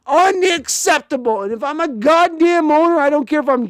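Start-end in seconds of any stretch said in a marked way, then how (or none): 0.1-1.4 higher, louder unacceptable again at pace; angry repeat. Real, not acted. Fan repeating his angry verdict on the team. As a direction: fire it off once more.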